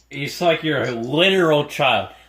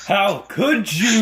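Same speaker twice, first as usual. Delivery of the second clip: drawn-out, higher melodramatic reproach; mock outrage; 6 semitones higher, slower than usual. Guard reacting to an insult to his mom, hammed-up outrage. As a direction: wail it slowly as if betrayed.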